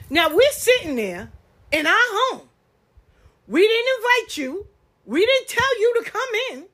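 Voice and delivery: loud, high, outraged ranting; rising indignant outburst, real heat. Real, not acted. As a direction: let the outrage build at the intruder. Indignant rant at intruder.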